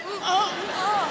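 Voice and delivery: hammed-up moaning, mm oh mm oh; pleasured moans. Comedian mimicking her own moans during a pat down. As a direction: moan it like you're enjoying it. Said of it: "People would be".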